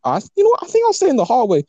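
high, rushed nervous blurt, hammed up; mock nervous squeak. Telling friends how he dodged the husband, mock nervous squeak.

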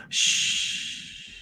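soft breathy hush; gentle shush. Acted. Gordon silencing the apprentice's lips, soft shush. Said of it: "Gordon hushes your lips".

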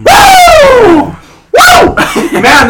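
loud high whoop; shouting. Real, not acted. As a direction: whoop loudly to test the echo. Testing echo by shouting.

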